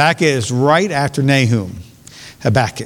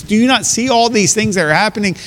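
high, urgent pleading question; desperate plea to God; 7 semitones higher than usual. Retold or imitated. Preacher voicing the prophet's cry to God, high and urgent. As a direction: beg God to step in. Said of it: "He says".

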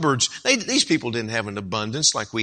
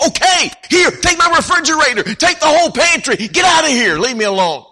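loud, much higher giving-up yell; exasperated shout; 8 dB louder than usual. Acted. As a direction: shout him out the door, fed up. Neighbor finally gives in.

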